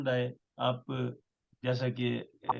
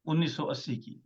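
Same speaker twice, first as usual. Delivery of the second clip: ordinary talk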